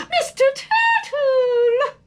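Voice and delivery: high, slow, thrilled squeal; a little girl squealing with joy; excited squeal. A narrator's reading. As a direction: squeal with delight at the find. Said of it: "she squealed excitedly".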